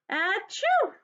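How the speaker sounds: sneeze. Acted. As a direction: fake a big sneeze. Elephant sneezes.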